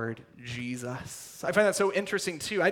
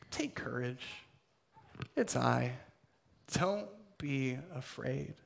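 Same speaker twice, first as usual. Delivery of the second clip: whispered reassurance; 3 semitones lower, 7 dB quieter than usual. Acted. Jesus calming frightened disciples.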